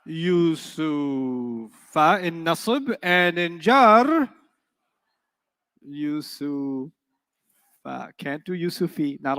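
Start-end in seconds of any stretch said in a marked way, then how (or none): 0.1-6.9 very slow, stretched Yusufa; slow demo. Shown as a demonstration. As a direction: drag Yusufa out syllable by syllable. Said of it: "drawn demo".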